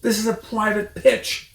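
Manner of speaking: high, loud squeaky shriek; shrieking Rat. A narrator's reading. Rat shrieks at Mister Fox to leave. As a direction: screech like an outraged rat. Rat shouting them away.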